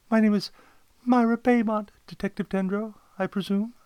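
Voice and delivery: high-pitched client introducing herself; polite inquiry in a high voice; client Myra Baymont. Acted. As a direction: greet the detective, pitched well up. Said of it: "she inquired".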